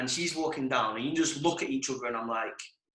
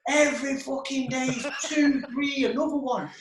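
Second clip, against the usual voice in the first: put-on high-pitched drunken call; hammed-up drunk demand, pitched way up; imitated drunk mate; 10 semitones higher than usual. Drunk mate at the balcony doors, imitated drunken call.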